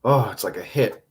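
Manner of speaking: usual speaking voice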